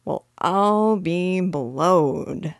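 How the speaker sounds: very slow, drawn-out burglar's amazement; uneducated burglar, flabbergasted, words dragged out; vulgar exclamation. A narrator's reading. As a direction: stretch every word in astonishment. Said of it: "once more uttered his vulgar exclamation".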